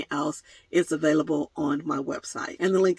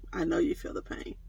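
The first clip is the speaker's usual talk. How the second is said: mock grief whisper; 2 semitones lower, 9 dB quieter than usual.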